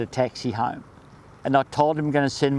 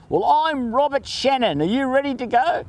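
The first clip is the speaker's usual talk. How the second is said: a hint of a booming, cheerful Robert, pitched higher; lightly voiced hearty bloke calling out; imitating a friend's big greeting; retold Robert; 10 semitones higher than usual.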